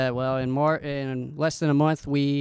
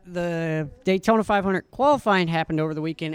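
usual speaking voice

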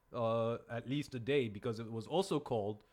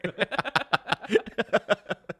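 laughing fit; 8 semitones higher, 9 dB louder than usual. Real, not acted.

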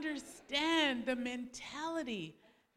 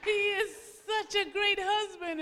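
much higher, slow, gushing mock admiration; swooning onlookers; 8 semitones higher, slower than usual. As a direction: play the crowd sighing over him. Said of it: "And then everybody would".

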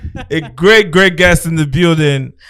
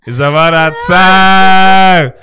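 slow, louder, stretched out; drawn-out catchphrase; 2 semitones higher, 6 dB louder, much slower than usual. Acted. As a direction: drag out "It's about that time" slowly and loudly.

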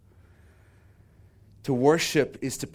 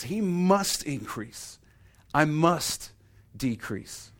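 higher, stretched "must", falling to "decrease"; slow, insistent stress on each "must"; emphatic; 4 semitones higher, much slower than usual. Retold or imitated. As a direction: drive "must" hard and slow. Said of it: "That's his emphasis".

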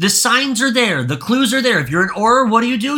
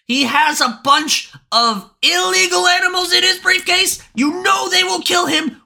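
building to a high frustrated shout; high-pitched furious yelling at the character; outraged rant; 4 semitones higher than usual. Real, not acted. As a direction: yell it like you can't believe it.

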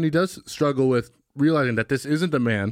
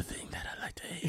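whisper; 19 dB quieter than usual. Real, not acted. Whispered aside.

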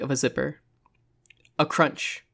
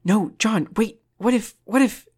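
high, halting plea; urgent, broken protest; anxious pleading; 8 semitones higher, 3 dB louder than usual. A narrator's reading. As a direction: beg him to stop, climbing in pitch. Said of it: "Carrie's quiet voice".

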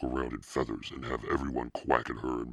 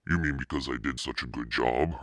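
usual speaking voice

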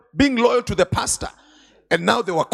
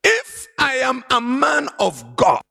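Elijah's fiery call; 4 semitones higher, slower than usual. Acted. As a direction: thunder it down like a prophet. Preacher acts Elijah calling fire.

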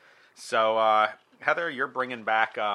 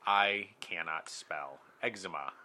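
slow, deliberate confession; slow announcement; slower than usual. Shown as a demonstration. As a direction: say it slowly. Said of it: "here it is for all of the world to hear".

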